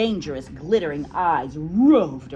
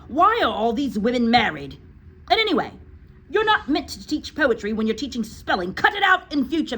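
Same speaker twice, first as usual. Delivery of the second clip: loud, high headmistress scolding; 7 semitones higher, 6 dB louder than usual. Acted. Trunchbull scolds Miss Honey about the song, loud and high. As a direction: berate the teacher loudly.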